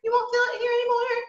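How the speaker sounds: demonstrating head voice up high. Shown as a demonstration. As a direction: lift it up into the head. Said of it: "once you come up here".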